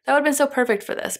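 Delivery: high, fast gush; remembered squeal of delight; recalled excited gush. Retold or imitated. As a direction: replay the excited thought. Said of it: "And I was like".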